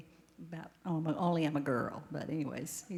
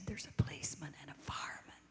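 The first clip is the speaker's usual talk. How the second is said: whisper; 3 semitones lower, 9 dB quieter than usual. Retold or imitated. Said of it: "he whispered when he answered".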